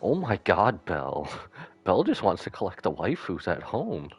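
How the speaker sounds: ordinary talk, nothing marked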